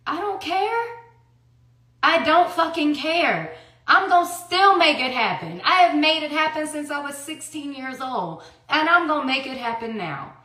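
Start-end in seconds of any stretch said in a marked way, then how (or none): 0.1-7.2 higher-pitched, emphatic defiance; real, heated determination; defiant vow. Real, not acted. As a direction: insist on it, voice pitched up. Defiant vow to provide regardless.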